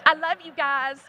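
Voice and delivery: loud, high call to a table. Real, not acted. Speaker calls out to her friends at table ninety-nine, loud and high. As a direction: shout it out to friends across the room.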